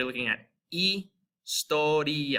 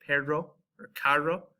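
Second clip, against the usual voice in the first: "perro or carro" with an English R, no trill; American accent. Shown as a demonstration. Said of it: "pronounce it just like we do here in the United States".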